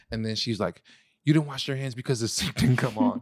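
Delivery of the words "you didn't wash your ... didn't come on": rising argument. Retold or imitated. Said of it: "octaves higher".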